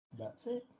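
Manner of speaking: matter-of-fact. Acted. Character sitting back after laying out his plan, matter-of-fact. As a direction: say it quietly, as a simple statement. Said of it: "matter-of-factly just kinda goes".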